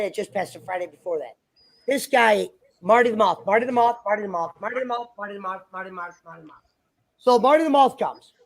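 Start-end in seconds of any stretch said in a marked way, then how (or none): 2.8-6.6 name rattled off faster, sinking to near silence; rapid fading repetition. Acted. Storyteller introducing this guy, name repeated in a quick fade. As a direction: start at talking level, then speed up and fade away.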